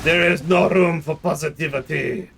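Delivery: hammed-up mix of French and German accents; deliberately terrible French accent crossed with German; bad French-German accent. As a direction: melodramatic villain in a muddled Franco-German accent. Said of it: "bad French accent combined with a bad German accent".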